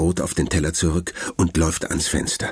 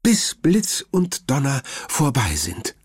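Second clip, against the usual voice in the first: high, lightly voiced worried grandmother; grandma's voice; 8 semitones higher than usual. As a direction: speak up high as the fussing grandma. (hohe, besorgte Oma-Stimme)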